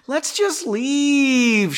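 high, slow, whining plea; whiny little girl. Acted. Astrid pleading with Henry to leave, whiny little girl. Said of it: "like a whiny little girl".

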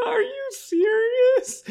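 very high, slow wailing sob; mock crying. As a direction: wail it like an exaggerated crybaby. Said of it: "He cried".

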